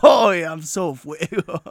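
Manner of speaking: high, slow laughing brag; laughing boast. Acted.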